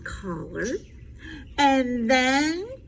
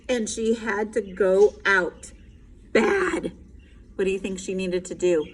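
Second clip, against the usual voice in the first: ordinary delivery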